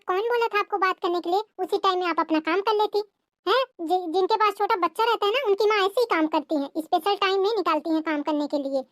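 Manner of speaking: high, scornful sister-in-law taunt. Acted. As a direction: sneer at her excuses in a high voice.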